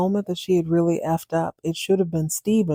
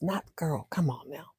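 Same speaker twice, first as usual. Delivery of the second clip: usual speaking voice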